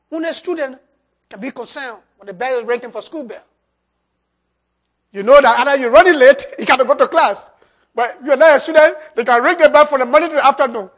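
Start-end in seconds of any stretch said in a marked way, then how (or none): 5.1-10.9 loud, driving sermon voice; preaching at full force. Acted. Preacher drives the school bell lesson to the congregation, loud. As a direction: hammer the bell lesson home.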